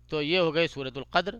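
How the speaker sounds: ordinary talk, nothing marked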